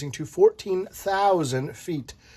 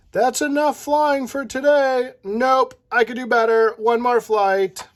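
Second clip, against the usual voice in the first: stubborn pilot; 9 semitones higher, 4 dB louder than usual. Acted. Instructor and stubborn pupil.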